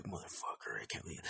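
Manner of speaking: muttered under the breath, barely voiced; angry murmur. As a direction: grumble it to yourself, almost a whisper. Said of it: "I heard him murmur".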